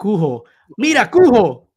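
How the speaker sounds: "Cujo" as koo-ho, building to a loud high call; Spanish-style H. Pretend. Teasing with the Spanish name, "Cujo" as koo-ho. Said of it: "supposed to be pronounced Cujo".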